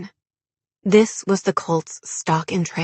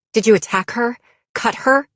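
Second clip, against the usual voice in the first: higher, urgent questioning; accusing questions; 5 semitones higher, 4 dB louder than usual. A narrator's reading. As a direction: press the suspect hard. Heroine interrogates the cult member.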